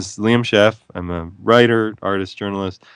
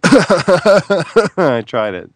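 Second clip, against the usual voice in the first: laughing; 8 semitones higher, 5 dB louder, much slower than usual. Real, not acted. Laughing at teenage smoking memory.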